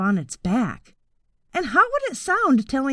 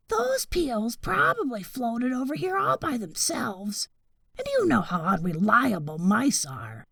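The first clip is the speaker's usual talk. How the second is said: a relaxed boar talking his partner down, softly; second boar, dismissive; 2 semitones higher, 6 dB quieter than usual. A narrator's reading. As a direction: brush the worries aside in a hush.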